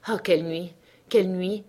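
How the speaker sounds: plain reading voice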